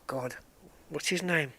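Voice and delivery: ordinary talk, nothing marked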